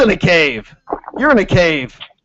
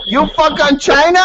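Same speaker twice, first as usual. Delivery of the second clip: mock broken English accent; 11 semitones higher than usual.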